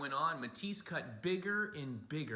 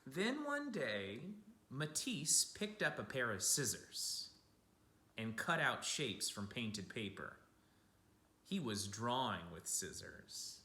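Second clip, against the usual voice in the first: gentle picture-book storytelling; storybook voice for kids; reading to children. A narrator's reading. Reads picture book aloud.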